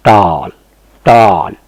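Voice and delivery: "टाल" said tawl, loud and drawn out; rounded "aw". Shown as a demonstration. Teacher drilling the rounded vowel, loud aw pronunciation. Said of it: "थोड़ा सा हल्का मुंह round करके".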